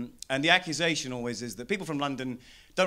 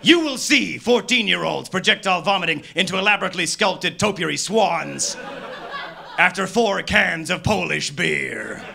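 building rant; 5 semitones higher, 5 dB louder than usual. Acted. Escalating rant about carnival.

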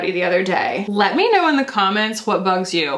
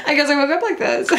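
laughing quote; 5 semitones higher, faster than usual. Retold or imitated. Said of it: "you're just like".

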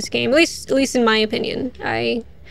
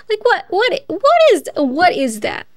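surprised; 7 semitones higher, 3 dB louder than usual. Real, not acted. Confused by the basement layout.

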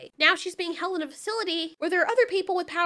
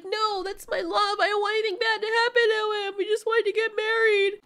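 put-on pleading girlfriend; 4 semitones higher than usual. Recapping the movie, voicing the girlfriend begging for her love, higher and pleading. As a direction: beg like she can't lose him. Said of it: "she's like".

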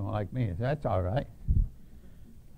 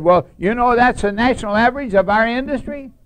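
defensive retort; 9 semitones higher, 12 dB louder than usual. Retold or imitated. Chairman defends himself.

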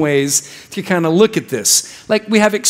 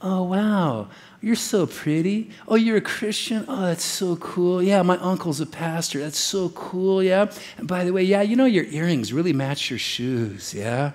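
smooth-talking flatterer; 7 dB quieter than usual. Retold or imitated. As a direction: coo it like a smooth guy working on a girl. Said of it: "The guy you need to look out for is the".

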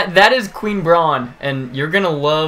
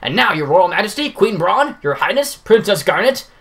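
grand stage announcer, raised pitch; Baku voice; 4 semitones higher than usual. Acted. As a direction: present the play to the whole hall. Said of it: "my Baku voice".

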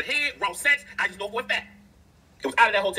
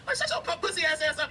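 mocking taunt; 6 semitones higher than usual. Mocking a crying rapper.

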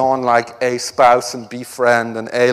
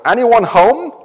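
robot voice; 5 semitones higher, 4 dB louder than usual. Retold or imitated. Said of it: "and then the robot says".

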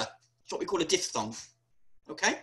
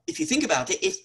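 ordinary delivery

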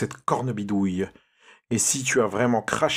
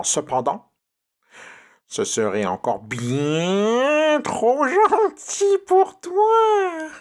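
witch voice. Acted. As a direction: menace him then screech. Witch threatens Pierre.

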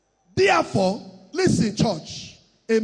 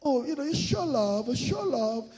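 mock pious talk; 7 dB quieter than usual. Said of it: "they will not allow".

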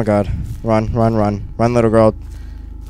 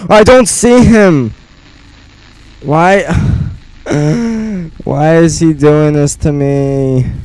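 scared whining; 5 semitones higher, 5 dB louder, slower than usual. Real, not acted. Frightened pleading in game.

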